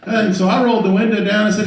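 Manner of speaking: normal talking voice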